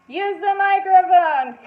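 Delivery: loud, slow, high heckler's yell; yelled from the crowd. Retold or imitated. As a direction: shout it from the back of the room. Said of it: "Somebody yells".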